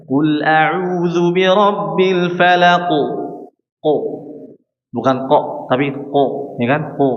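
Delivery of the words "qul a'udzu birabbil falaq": falaq closed with a clean bouncing qaf; correct ending. Shown as a demonstration. Teacher chants the correct version slowly. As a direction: end falaq on a clear qalqalah qaf. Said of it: "Harusnya gimana?".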